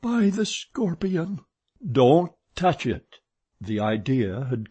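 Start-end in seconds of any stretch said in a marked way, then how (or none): 0.0-1.4 high-pitched woman's reply; Christine calling back. A narrator's reading. Christine calls back to narrator. 1.9-3.2 loud, firm warning cry; urgent warning. A narrator's reading. Urgent warning shouted to Christine.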